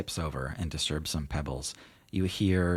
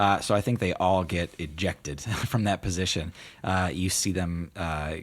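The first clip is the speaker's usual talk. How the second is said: ordinary delivery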